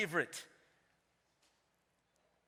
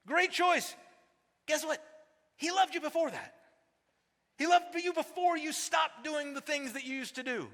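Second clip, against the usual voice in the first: preaching at full force; 9 semitones higher than usual. Real, not acted. Preacher building to a climax.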